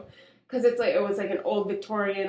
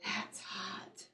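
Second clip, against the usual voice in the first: breathy whispered "That's hot"; 17 dB quieter than usual. Retold or imitated. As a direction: whisper it like a socialite. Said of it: "almost [name]-y".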